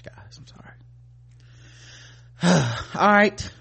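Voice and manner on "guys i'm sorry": barely audible sorry; sheepish whisper; whispered apology. Real, not acted. Whispered apology after insult.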